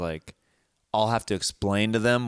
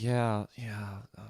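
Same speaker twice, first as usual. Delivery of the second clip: subdued, let-down agreement; deflated; 7 dB quieter than usual. Retold or imitated. Son answers Dad who praised Jimmy Fallon over his impressions, deflated. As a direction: give in quietly, wind out of your sails. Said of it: "completely taking the sale out".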